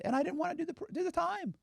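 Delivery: high, squeaky mock whine. Mimicking snitches' excuse in a high squeaky whine. Mocking voice of the cooperators.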